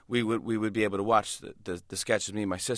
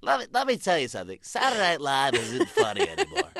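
morning zoo DJ; 7 semitones higher than usual. Retold or imitated. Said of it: "I'm like".

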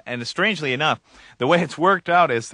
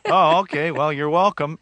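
laughing reply; 7 semitones higher, 6 dB louder than usual. Retold or imitated. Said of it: "it was like".

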